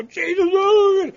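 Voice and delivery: high, slow, loud yelp of pain; boy howling over a smashed thumb; pained cry. Retold or imitated. Hit thumb with hammer.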